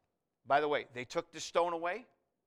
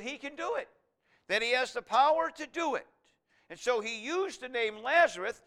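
raised, higher-pitched pulpit proclamation; impassioned preaching; 5 semitones higher, 6 dB louder than usual. Real, not acted. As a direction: proclaim the resurrection with mounting excitement. Preaches the coming resurrection with force.

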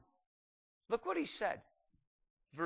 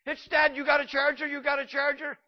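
high, loud panicked pleading; frantic customer; 10 semitones higher, 8 dB louder than usual. Retold or imitated. Customer rushing into the store, frantic loud plea. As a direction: beg the clerk for a charger.

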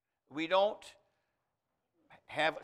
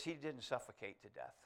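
soft, kind, polite correction, much softer; gentle correction; 4 semitones lower, 12 dB quieter than usual. Retold or imitated. Senior pastor correcting the preacher boy, softly and kindly. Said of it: "He said it very kindly, very gently, very politely".

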